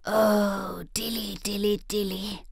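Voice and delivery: a hint of a sighing cat. A narrator's reading. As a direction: sigh it out wistfully, trailing off. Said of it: "sighed Simpkin".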